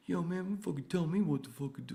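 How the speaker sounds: high, loud, put-on aggressive voice; hammed-up hothead coworker. Coworker yelling not to be told what to do, mock loud voice. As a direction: caricature the angry coworker.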